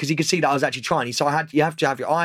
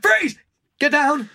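high, loud, urgent police order; acted police shout with jeopardy; policeman's shouted command; 11 semitones higher, 3 dB louder, much slower than usual. Shown as a demonstration. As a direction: shout it like an armed officer bursting in. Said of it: "show a little bit of jeopardy in your voice".

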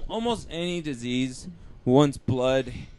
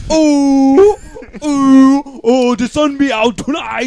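mock mystic voice; 8 semitones higher, 13 dB louder, much slower than usual. Answering the Jamaican bit.

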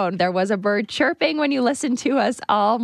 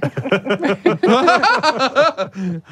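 a short flirty chuckle; light laugh; 4 dB louder than usual. Real, not acted. Laughing at her own flirty line. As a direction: laugh it off after teasing.

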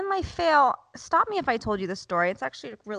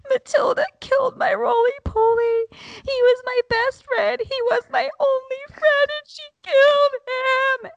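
imitated bawling; 5 dB louder than usual. Son mourns his roly-poly to mom, imitated bawling. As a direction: imitate a child bawling out an accusation, piercingly high.

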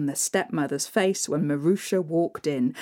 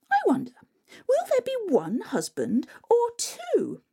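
much higher, airy dreamy voice; giddy stepsister; 13 semitones higher than usual. Acted. A stepsister dreams of husbands, high and giddy. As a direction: squeal it like a silly girl.

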